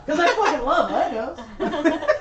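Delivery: mock gushing.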